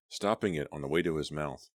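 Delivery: plain reading voice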